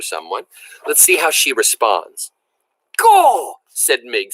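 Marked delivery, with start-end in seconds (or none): 3.0-3.6 high, loud pleased Gaw; satisfied exclamation. A narrator's reading. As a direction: cry it out content.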